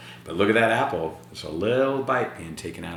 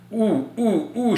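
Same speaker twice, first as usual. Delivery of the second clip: mother cooing; 12 semitones higher, slower than usual. Acted. Mother peers into mouth.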